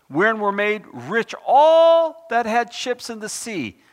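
loud, slow, solemn scripture reading. Acted. Preacher reads Revelation to the congregation, loud and slow. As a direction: proclaim the verse slowly to the congregation.

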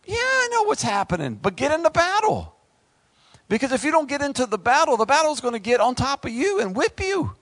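fiery sermon peak; preaching at full force. Acted. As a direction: brush off the fear and rally them. Preacher answers the doomsayers.

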